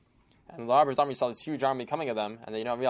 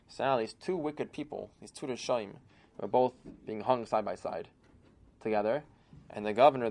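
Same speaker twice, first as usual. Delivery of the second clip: ordinary delivery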